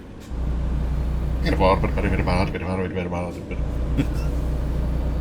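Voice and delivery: rapid auctioneer gibberish chant. Retold or imitated. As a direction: rattle off fake bidding like an auctioneer. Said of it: "my best impression of an auctioneer".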